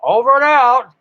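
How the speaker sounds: high, loud mocking "Over and out"; mock newbie. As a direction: ham up the rookie's radio sign-off. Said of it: "instead of saying ten four".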